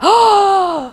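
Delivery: high sharp gasp of surprise; shocked gasp. Retold or imitated. Men shocked again.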